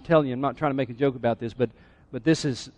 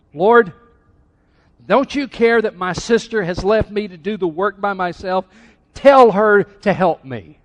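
raised, insistent acted complaint; angry, frustrated plea; 4 semitones higher, 7 dB louder than usual. Martha asking the Lord about her sister, angry and frustrated. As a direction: demand it loudly, slowing hard on the last plea. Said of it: "Martha is so angry".

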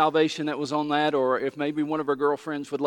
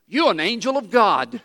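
amazed exclamation; 6 semitones higher, 6 dB louder than usual. Retold or imitated. Amazed lady exclaims to pastor.

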